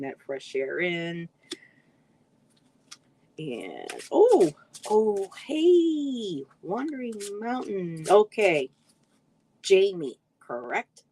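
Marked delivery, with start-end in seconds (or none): 4.1-8.1 high, loud "oh" of happy recognition; surprised greeting. Real, not acted. Streamer spotting Wandering Mountain in the chat, surprised greeting. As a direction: leap up in pitch, spotting someone unexpectedly. Suddenly spots a viewer in chat.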